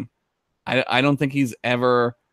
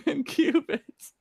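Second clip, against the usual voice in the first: laughing; 13 semitones higher, 7 dB quieter, slower than usual. Real, not acted. Laughing echo, high voice.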